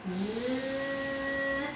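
soft drawn-out buzz; bee voice. Shown as a demonstration. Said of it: "Suara lebah kayak gimana".

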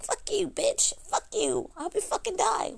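mom's curses mimicked high-pitched; mock her mother's hateful outburst, pitched high; imitating mom cursing. Mom's retold curse in the row.

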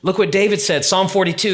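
ordinary talk

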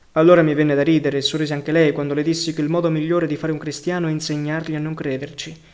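plain reading voice

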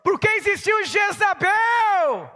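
high, loud, stretched-out cry; slow preaching shout. Real, not acted. Pastor crying out a question to the church, slow and loud. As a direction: draw it out and shout it to the crowd.

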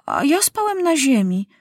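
put-on high, childish interjection; King Maciuś interrupting the doctor's list; boy king's voice. A narrator's reading. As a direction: cut in like a proud boy. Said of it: "wtrącił Maciuś".

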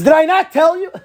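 high, loud scolding Reuven; mock I-told-you-so. Rabbi voices Reuven scolding his brothers, loud and high. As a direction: rub it in like a scolding older brother.

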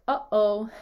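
"uh-oh" with a clear catch between the syllables; glottal stop. Shown as a demonstration. Teacher giving a familiar example, glottal stop. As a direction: stop the throat sharply between "uh" and "oh". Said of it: "you have the glottal stop there".

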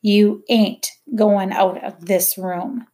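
each word set down slow and heavy; heavy, solid words. A narrator's reading. Mother laying down the law, slow and heavy. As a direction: lay every word down like a stone. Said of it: "each word heavy and solid".

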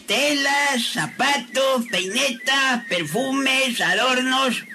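high-pitched scornful listing of wares; indignant boasting. Acted. Shop owner belittling a rival's store, indignant boasting. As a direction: run down the rival, item by item.

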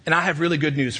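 ordinary talk

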